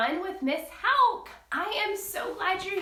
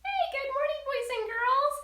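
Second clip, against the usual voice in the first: much higher friendly little rabbit voice; cheerful rabbit puppet; 8 semitones higher than usual. Acted. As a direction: greet the kids as the bunny. Puppet rabbit greets kids.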